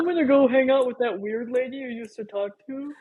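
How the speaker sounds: high-pitched imitation of a child; put-on kid voice. Friend voicing the kids about the weird lady, put-on high voice. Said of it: "your kids can find it and be like".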